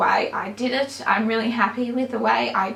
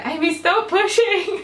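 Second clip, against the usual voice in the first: mock yell, higher and half-laughing; husband's laughing mock shout at his wife in labour; imitating a yell; 6 semitones higher than usual. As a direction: play her husband's urgent yell at her. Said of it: "Jason yelling in my ear".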